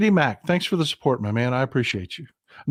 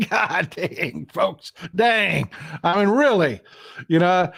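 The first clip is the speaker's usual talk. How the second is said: amused disbelief; laughing; 2 semitones higher, slower than usual. Real, not acted. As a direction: shake your head and laugh through it. Still laughing after the quote.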